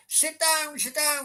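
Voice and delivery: high, mock-stern old folks; imitating grumpy old people hushing him; high-pitched grumpy pensioner voice; old fogies. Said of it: "the old fogies behind me, like".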